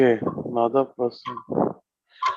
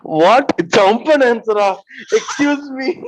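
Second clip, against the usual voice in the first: higher, louder disbelieving what; surprised; 8 semitones higher, 6 dB louder, slower than usual. Real, not acted. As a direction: react in disbelief at the penalty. Player hears silly penalty.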